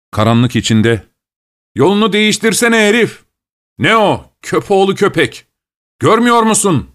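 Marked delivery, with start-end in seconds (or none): 1.7-6.9 higher, yelling voices from the dark; angry shouts. A narrator's reading. Passers-by in the dark yell at the sleigh driver, angry shout. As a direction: bark it at the careless driver. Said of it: "sesleri işitilir".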